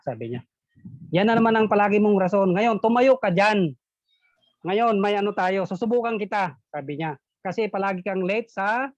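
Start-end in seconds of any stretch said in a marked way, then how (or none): 1.1-6.6 louder, fast teacher telling off; mock stern order. Teacher ordering Juan to stand, loud mock scolding. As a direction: snap the order at the pupil.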